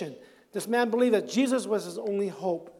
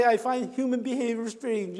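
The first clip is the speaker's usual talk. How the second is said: normal talking voice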